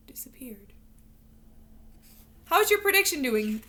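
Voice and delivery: ordinary delivery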